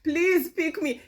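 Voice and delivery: high, loud mock pleading; hammed-up begging for attention; mock pick-me. Mimicking a woman desperate for men, mock pick-me. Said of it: "Don't be a pick-me".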